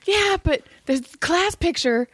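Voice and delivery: put-on high, whiny little boy; kid voice. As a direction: pitch up like a pouting kid. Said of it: "he goes".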